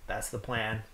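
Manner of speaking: normal talking voice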